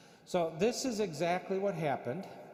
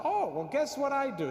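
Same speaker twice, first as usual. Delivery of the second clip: higher, louder, perky reveal; newly confident; 9 semitones higher, 5 dB louder than usual. Retold or imitated. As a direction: perk up and drop the hint with fresh confidence. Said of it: "then I got a little more confidence".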